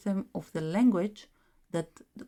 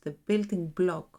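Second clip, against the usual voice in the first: ordinary delivery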